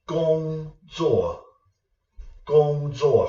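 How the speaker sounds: 工作 with 工 high and level, 作 falling sharply; teacher modelling 工作 as high level then sharp fall; Mandarin tones. Shown as a demonstration. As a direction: say 工作 as first tone then fourth tone. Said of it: "First tone, fourth tone".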